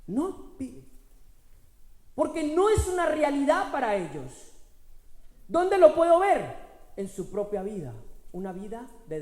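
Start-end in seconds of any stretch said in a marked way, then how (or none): 2.1-6.6 loud, high, building preacher's cry; preaching at full force. Acted. Pastor proclaiming to the congregation, loud and rising. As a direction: proclaim the power within, rising.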